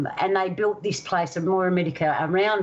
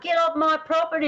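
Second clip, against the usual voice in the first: much higher mock yell; ghost yelling; 9 semitones higher than usual. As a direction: shout him off the land like an angry ghost. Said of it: "yelling out".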